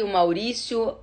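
ordinary talk, nothing marked

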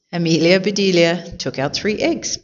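ordinary delivery, nothing marked

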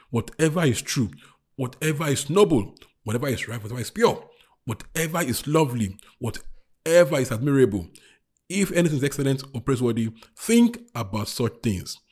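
firm, emphatic list in parallel phrases; scripture recitation. Real, not acted. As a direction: give each 'whatever' its own weight. Preacher reads scripture aloud.

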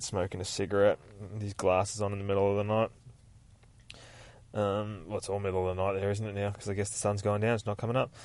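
ordinary delivery, nothing marked

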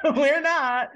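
high, loud mock protest; called-out denial. [name] calling back to the hairdresser, high and loud. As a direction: pitch it right up and call it out.

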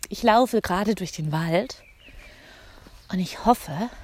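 panting while walking uphill; slightly winded from the climb; out of breath. Real, not acted. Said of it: "Es geht jetzt gerade ein bisschen bergauf".

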